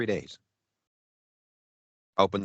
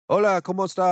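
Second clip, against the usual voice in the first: high, singsong Spanish greeting; mock cheery welcome in Spanish; imitated chirpy hola; greeting shop locals; 8 semitones higher, 4 dB louder, faster than usual. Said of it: "and they're like".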